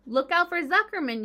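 loud, high yell of warning; gander yelling. A narrator's reading. Gander warns the fleeing pig, loud high yell. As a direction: yell it across the yard. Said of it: "yelled the gander".

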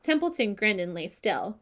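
plain reading voice

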